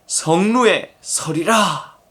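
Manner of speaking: 성루에 서리라 drawn out very slowly; slow emphasis. Shown as a demonstration. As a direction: stretch each word of 성루에 서리라. Emphatic repeat of scripture phrase. (성루에 서리라를 아주 느리게 강조)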